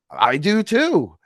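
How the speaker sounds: high, slow eager agreement. Retold or imitated. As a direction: say it with eager glee. Agreeing with grandmother.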